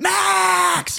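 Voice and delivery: high shout of "Max"; frantic yell. Retold or imitated. Owner yelling for the escaped dog, shouted. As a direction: scream the dog's name as it runs off. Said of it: "runaway dog scene".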